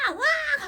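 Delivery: high shrieking mock wail of a crying toddler. Imitating a toddler who bursts out crying at mom's door: high loud shriek. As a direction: shriek like a tantrum child, hammed up. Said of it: "하고 울어요".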